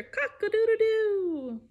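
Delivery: high, loud rooster crow; full-throated cock-a-doodle-doo. Pretend. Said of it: "like a rooster".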